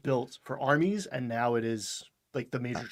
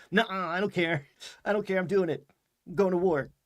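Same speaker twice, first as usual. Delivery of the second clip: put-on bratty, raised teen whine; defiant teenager; 7 semitones higher, 4 dB louder, faster than usual. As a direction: pout like a stubborn teenager. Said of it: "teenage impulse of like".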